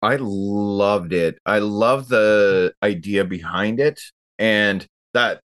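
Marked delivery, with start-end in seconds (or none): none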